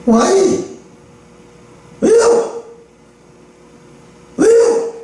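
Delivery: sharp high-pitched "why?", demanding; indignant protest. Retold or imitated. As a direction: cry out why like a child arguing. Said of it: "막 따졌어".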